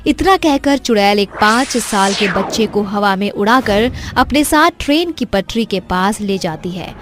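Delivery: ordinary delivery, nothing marked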